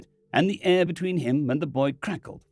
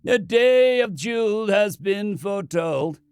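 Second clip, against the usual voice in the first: solemn priest; 7 semitones higher, 4 dB louder, slower than usual. Acted. Priest intones slowly.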